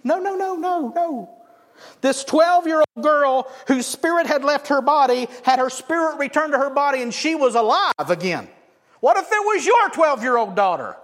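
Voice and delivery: loud, high urgent preaching; impassioned insistence. Real, not acted. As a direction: drive the point home at full voice. Preacher rebukes a bored response.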